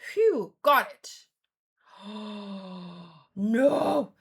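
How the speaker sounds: usual speaking voice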